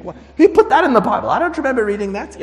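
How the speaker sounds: high, loud burst of disbelief; mock astonishment. Forgetful reader rediscovering a Bible verse, astonished. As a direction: blurt it out, incredulous and amused. Acts surprised rereading the Bible.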